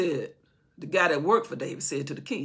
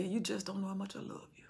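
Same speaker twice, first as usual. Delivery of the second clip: soft whispered love; tender whisper; 11 dB quieter than usual. Acted. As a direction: whisper it like God comforting a child. God voiced, tender.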